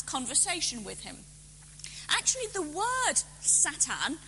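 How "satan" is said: Satan in Hebrew, sah-TAHN; Hebrew pronunciation. Shown as a demonstration. Lecturer explaining the word for accuser, Hebrew pronunciation. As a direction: give Satan two open A vowels, not SAY-tn. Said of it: "I'm saying it in the Hebrew pronunciation".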